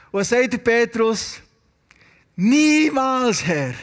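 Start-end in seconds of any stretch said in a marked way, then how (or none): 2.4-3.7 raised, drawn-out imitated protest; emphatic refusal. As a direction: play Peter's absolute no. Peter's shocked refusal in prayer.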